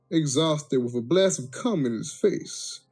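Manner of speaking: ordinary delivery, nothing marked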